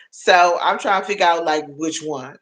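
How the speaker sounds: normal talking voice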